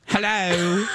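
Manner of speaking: a light mock British Hello; boyfriend's mock British greeting; fake British accent. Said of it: "with his fake British accent".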